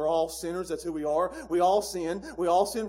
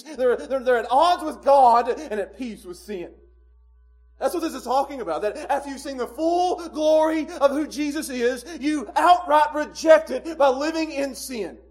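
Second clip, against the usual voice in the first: louder, slow, pressing preacher's cry; full-force preaching; 8 semitones higher, 9 dB louder than usual. Acted. Preacher warning about rejecting Jesus, slow and loud preaching. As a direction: drive it home with weight.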